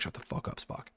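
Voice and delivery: harsh whispered shut-up, no voice at all; voiceless whispered snap at Spock; whispered put-down. Retold or imitated. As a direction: hiss it under your breath to Spock. Kirk telling Spock off.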